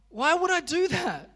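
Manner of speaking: sudden loud incredulity; incredulous. Retold or imitated. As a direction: ask it as if the idea is absurd. Incredulous self-question in sermon.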